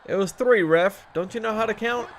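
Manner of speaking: shouting at the ref in disbelief; loud, outraged complaint; angry yell. Real, not acted. Yelling at the referee.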